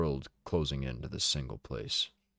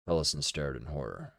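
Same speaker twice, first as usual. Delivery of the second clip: ordinary delivery, nothing marked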